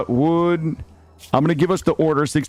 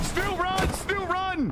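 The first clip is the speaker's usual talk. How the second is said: high-pitched urgent calling; urgent warning; 7 dB quieter, slower than usual. Real, not acted. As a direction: call out for a friend to flee. Urging teammate to flee.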